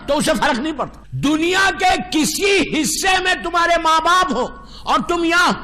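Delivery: ordinary delivery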